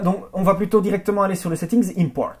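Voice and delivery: normal talking voice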